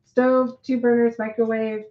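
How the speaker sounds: ordinary talk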